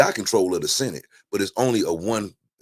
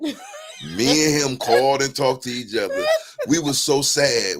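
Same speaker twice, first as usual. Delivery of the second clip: higher and slow, a mock-mournful half laugh; half-laughing lament; 6 semitones higher, slower than usual. Real, not acted. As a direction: ham up the sadness, slow. Retelling grief over debate loss.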